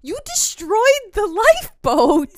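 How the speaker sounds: a shrill hammed-up accusation, stretched out; imitating Robert. A fan imitating Pattinson's line, high loud mock wail. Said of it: "I love how Robert goes".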